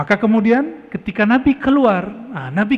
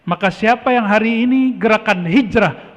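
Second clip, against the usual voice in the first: ordinary talk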